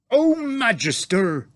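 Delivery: loud, slow, high giant cry; eager giant hailing the Queen. A narrator's reading. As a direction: call out to her with giant delight. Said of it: "cried the B F G".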